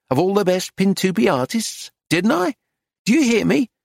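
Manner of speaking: rising, high-pitched insistence; anxious. A narrator's reading. Dealer presses the silent painter, anxious. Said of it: "Her voice sounded anxious".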